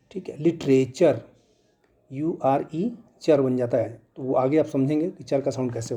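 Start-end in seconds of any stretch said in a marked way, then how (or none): none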